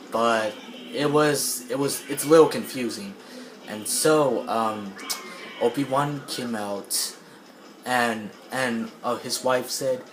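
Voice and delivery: ordinary delivery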